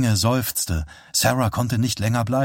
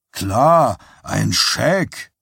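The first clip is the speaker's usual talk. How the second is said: contemptuous; much slower than usual. A narrator's reading. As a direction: scoff at the very idea. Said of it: "mit unendlicher Verachtung". (voller Verachtung)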